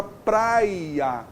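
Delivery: "praia" drawn out as prai, then a; slow "praia", audibly breaking before the last a; split syllables. Shown as a demonstration. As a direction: stretch "praia" so it divides into two syllables. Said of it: "Opa, dividiu".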